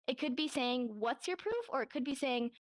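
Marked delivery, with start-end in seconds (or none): 1.0-1.6 "What's your proof?" high, rising on "proof"; questioning. Shown as a demonstration. Showing what the sign could be saying, questioning "What's your proof?". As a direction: challenge them, lifting "proof" into a question. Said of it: "you're kind of questioning them".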